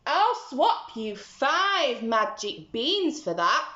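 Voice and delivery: slow, tempting trader's voice; a strange little man making a deal; sly little man. Acted. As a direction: tempt him with the beans. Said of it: "the man said".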